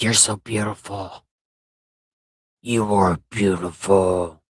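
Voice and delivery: low, slurred robot voice; deep monotone robot crooning; drunken robot voice. Pretend.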